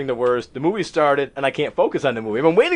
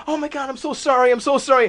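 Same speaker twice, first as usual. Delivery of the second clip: high-pitched mock apology; apologetic girl; 9 semitones higher than usual. Girl who spilled the icy apologizing, high imitated fluster. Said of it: "This girl's like".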